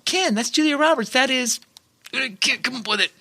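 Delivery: high, teasing listener voice; mocking listener. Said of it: "the people listening on the other end be like".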